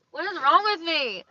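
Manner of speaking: stuffy, snotty nose; talking through a runny nose. Real, not acted. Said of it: "I'm snotting everywhere".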